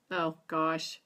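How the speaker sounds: ordinary talk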